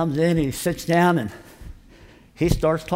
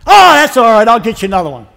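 much higher and louder, bubbly and forgiving; cheerful reassurance; 8 semitones higher, 15 dB louder than usual. Retold or imitated. Dave forgiving the lost Bible, loud and cheerful. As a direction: brush it off with big cheer. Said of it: "Dave goes".

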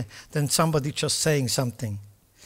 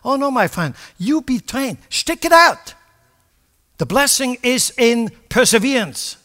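louder, higher, forceful exhortation; preaching at full force; 8 semitones higher, 7 dB louder than usual. Acted. Preacher urging the congregation to persevere, at full force. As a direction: drive it home like a fired-up preacher.

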